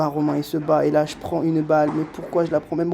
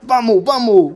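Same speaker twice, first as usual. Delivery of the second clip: higher and louder triumphant cry; victory shout; 6 semitones higher, 7 dB louder, slower than usual. Real, not acted. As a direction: shout it for the win. Match point won. (cri de victoire)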